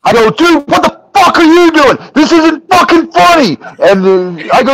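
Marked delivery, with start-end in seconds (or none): 0.3-3.6 high, heated outburst; retold furious fit. Retelling his rant at the laughing cameraman, high and furious. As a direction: re-enact losing your temper, pitch way up. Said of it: "I was throwing a fit".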